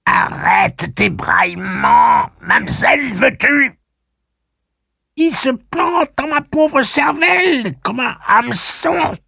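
very high, loud screeching crone; strident crow voice. Acted. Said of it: "une voix stridente de corneille de pluie".

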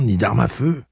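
ordinary delivery, nothing marked

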